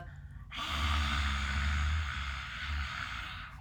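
long breathy growling exhale; lion's breath. Shown as a demonstration. Instructor shows listeners a breathwork roar, long growly exhale. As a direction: exhale hard and long through an open throat. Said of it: "create a little bit of vibration or growl in the throat".

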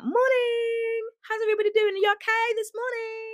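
high-pitched chirpy morning hello; overly cheery morning person. Retold or imitated. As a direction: greet the office like an irritatingly chipper early riser. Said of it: "they come in with that energy".

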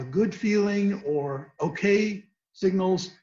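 usual speaking voice

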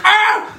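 a sudden high frightened yelp; startled yelp. Retold or imitated. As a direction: jump out of your skin with a short cry. Passenger startled by tapping.

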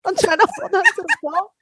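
high, laughing through the words; laughing while retelling the mixing bowl chaos. Real, not acted. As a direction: talk while cracking up.

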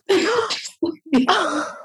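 high, rueful laugh; real laugh. Real, not acted. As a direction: laugh at the absurd mix-up. Laughing at the mix-up.

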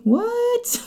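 sudden very high squeak of disbelief; jaw-drop surprise, voice jumps way up; stunned what. Retold or imitated. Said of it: "I was like".

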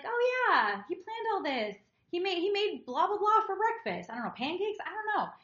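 gushing partner. Retold or imitated. Mocking the camper's partner at the festival, gushing high. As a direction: imitate a starry-eyed woman bragging about her man. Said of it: "And she's like".

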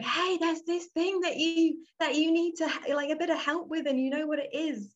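high-pitched urgent voice, not really screaming; mock screaming intuition. Intuition nagging us about needing help, high mock scream. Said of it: "like, screaming at us".

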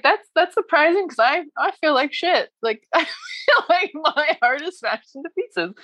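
high, laughing self-pity; mock disbelief. Answering friends' compliments, high rueful disbelief. Retold own reply.

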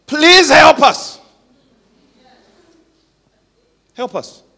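desperate emotional plea; pleading cry. Real, not acted. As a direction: cry out for help. Pleading outburst.

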